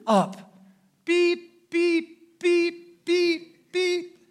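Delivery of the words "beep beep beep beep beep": gentle horn taps. Retold or imitated.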